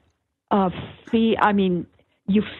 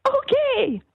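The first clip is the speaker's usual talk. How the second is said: mock yell. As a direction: imitate yelling out in a high voice. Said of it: "I would yell out".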